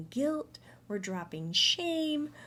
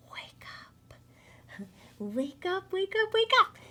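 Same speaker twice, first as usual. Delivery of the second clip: playful wake up call; 12 semitones higher, 5 dB louder than usual. Pretend.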